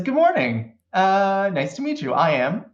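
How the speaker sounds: a lightly voiced cheerful android. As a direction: greet them like a freshly booted android.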